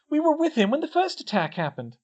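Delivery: high-pitched, insistent defence; distressed protest. A narrator's reading. Hermione giving Harry an alibi, distressed. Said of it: "Hermione stammered".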